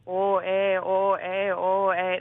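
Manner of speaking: mock Canadian guy going oh eh; a hammed-up Canadian oh, eh; Canadian accent. Comedian imitating a Canadian co-star in a Canadian accent. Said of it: "paired me with a Canadian guy".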